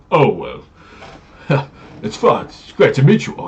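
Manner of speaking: high, loud, hammy gentleman greeting with a laugh; pompous paladin. Acted. GM voicing the pompous paladin meeting the party, hammy and loud. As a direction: puff up and play the grand, self-loving knight.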